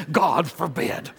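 slow, shuddering mock outburst; imitating excited professor. Preacher mimicking his old professor reading Paul, shaking outburst. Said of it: "he just starts shaking his head like this".

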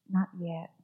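a hushed, calm no from the master; quiet firm refusal. Acted. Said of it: "he only nodded".

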